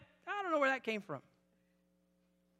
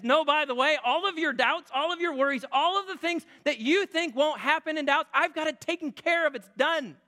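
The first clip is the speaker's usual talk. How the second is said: emphatic reassurance; 7 semitones higher, 4 dB louder than usual. Acted. God's reassuring voice, preached.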